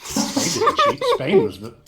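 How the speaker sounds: high, loud indignant protest. Pretend. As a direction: insist hotly. Defending Spain, raised voice.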